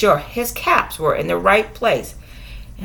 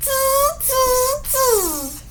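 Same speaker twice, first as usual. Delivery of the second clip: monkeys tutting; 5 dB quieter than usual. Acted.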